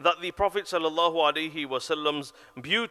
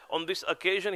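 ordinary delivery

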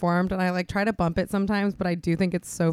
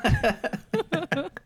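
laugh; 8 semitones higher than usual. Real, not acted. Laughing after the bit.

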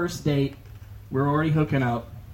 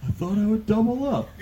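mock girl voice; 7 semitones higher than usual. Girl defending Vegas trip.

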